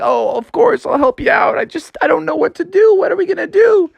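high, fretful cartoon voice; anxious Froggy. A narrator's reading. Froggy answering the sheriff, high and increasingly frantic.

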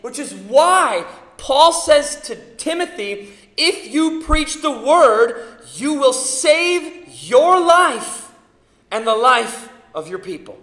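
loud, high, slowing preacher's cry; preaching at full force. Acted. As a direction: drive it home from the pulpit.

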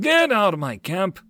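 high-pitched outraged order; angry cry. Acted. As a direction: cry out as an irate merchant. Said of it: "the red-faced merchant cried out".

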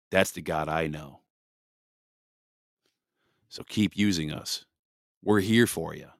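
earnest spoken prayer to God. Real, not acted. Leads a prayer.

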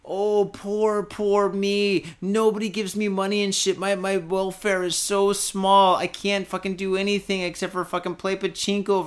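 high, mocking whine; mocking whiny victim. Mocking the self-pitying man on the train, high whine. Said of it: "He's like, he's like".

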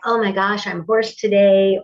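ordinary delivery

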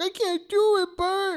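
high, pleading voice; mock whiny fear. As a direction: whimper it like a scared kid. Frank refusing to Bert.

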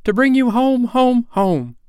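put-on high, loud, gleeful child; small sister overjoyed, ringing out the news; little girl. A narrator's reading. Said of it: "bending down to laugh".

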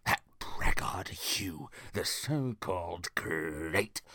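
slow, low, contemptuous king; scornful king. Acted. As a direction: draw out your scorn for a rival. King scorns his rival.

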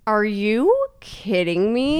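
slow, drawn-out incredulous question; retold indignation, stretched out and rising; mock outrage. Exhausted runner reacting to an upbeat passer-by, mock outrage. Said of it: "we were so mad".

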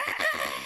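very high sudden "Ah!"; imitated high-pitched gasp; mock frantic shriek. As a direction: shriek like a panicked mother. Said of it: "how Jamie Lee Curtis acts".

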